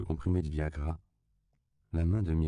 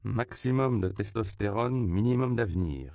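scathing; 4 semitones higher, 3 dB louder than usual. A narrator's reading. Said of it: "résuma Myriam cinglante".

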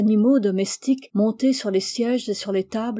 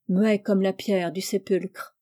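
ordinary delivery, nothing marked

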